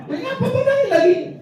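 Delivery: very high, laughing wife voice; a giggling falsetto young wife; a young wife asking her tearful husband why he cries, in a high laughing voice; squeaky wife. Retold or imitated. Wife asks husband why crying.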